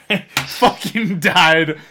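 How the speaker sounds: laughing through the words. Real, not acted. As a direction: crack up while saying it. Laughs while telling.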